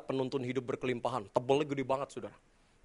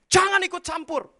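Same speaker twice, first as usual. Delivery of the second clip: loud, high furious snap; angry shout; 9 dB louder than usual. Retold or imitated. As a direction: yell it at the pastor's face. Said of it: "dia bilang". (bapak itu membentak pendeta dengan marah)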